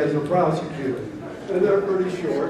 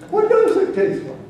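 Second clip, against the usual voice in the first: pitched way up, laughing through it; eager high question; 5 dB louder than usual. Retold or imitated. Judge eagerly asks the defendant, high and laughing. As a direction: leap up in pitch with glee.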